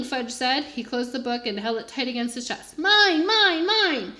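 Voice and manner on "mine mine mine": put-on high, loud toddler chant. A narrator's reading. Toddler claims the book.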